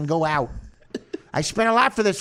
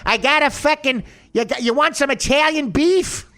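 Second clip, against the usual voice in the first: put-on Chicago mob accent; 7 semitones higher, 4 dB louder than usual. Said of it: "when he does the Chicago thing".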